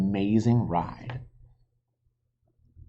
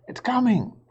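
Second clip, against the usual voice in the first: put-on high, loud girl's shout; shouted warning; 13 semitones higher, 3 dB louder than usual. Sister warns her brother of the dinosaur, shouting. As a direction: yell it in alarm. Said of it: "shouted Annie".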